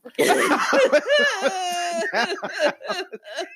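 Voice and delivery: high helpless laugh, almost crying; hooting laugh. Real, not acted. Laughing at her own mistake, real helpless high laughter. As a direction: break down laughing at herself.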